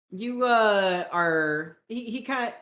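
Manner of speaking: normal talking voice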